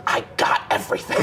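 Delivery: imitated menacing passenger. Woman back from reading license plate, mock-menacing rasp. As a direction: mimic her sinister announcement in a harsh whisper.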